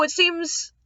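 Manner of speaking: plain reading voice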